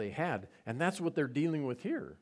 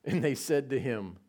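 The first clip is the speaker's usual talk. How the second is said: ordinary delivery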